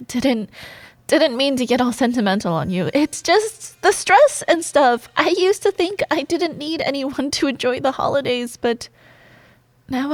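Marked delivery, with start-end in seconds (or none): 2.9-5.1 higher, louder, defensive brush-off; flustered excuse. Acted. Woman excusing her sentimental moment to coworker, flustered. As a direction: blurt it out to cover embarrassment.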